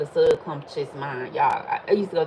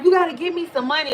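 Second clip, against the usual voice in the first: mock demand; 9 semitones higher, 3 dB louder, faster than usual. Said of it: "he was like".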